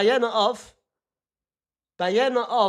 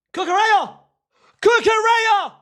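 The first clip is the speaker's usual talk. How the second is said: screaming the name high and loud; ecstatic shout; 11 semitones higher, 7 dB louder than usual. Real, not acted. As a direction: yell it like a goal just went in. Goal celebration calling name.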